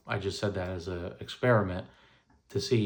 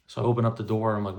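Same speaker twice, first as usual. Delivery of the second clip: ordinary talk, nothing marked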